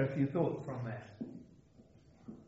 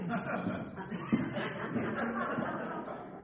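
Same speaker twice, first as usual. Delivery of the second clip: long helpless giggle; real laughter; 11 semitones higher, 6 dB quieter than usual. Real, not acted. Preacher amused at how catwalk models walk, laughing. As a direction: burst out laughing at your own remark.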